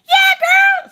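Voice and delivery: very high, loud squeal of agreement; over-the-top excited cheer; hyped-up yes. Acted. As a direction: burst out high and thrilled. Excited customer answering Alexa.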